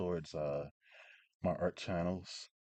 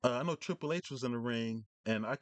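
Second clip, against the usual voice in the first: normal talking voice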